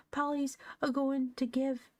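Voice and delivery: put-on high, mildly blubbering child. A narrator's reading. Little Joel protests through tears, blubbering. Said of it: "blubbered Joel, wiping his tears away".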